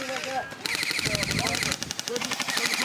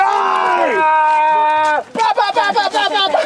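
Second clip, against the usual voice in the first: loud high ba ba ba bursts; shouted gun noises; 6 semitones higher, 9 dB louder, much slower than usual. Real, not acted. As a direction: shout mock gunfire. Firing, imitating gunfire.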